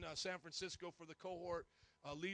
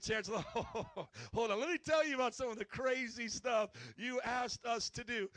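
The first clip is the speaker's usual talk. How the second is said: laughing, louder teasing protest; 3 semitones higher, 8 dB louder than usual. Retold or imitated. Graduates teasing the Chancellor about crazy requests, laughing loudly. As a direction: chuckle through it, then tease. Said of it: "we were like".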